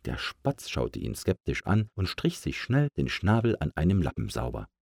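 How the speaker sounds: ordinary delivery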